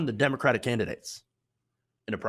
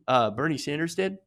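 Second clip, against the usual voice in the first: ordinary delivery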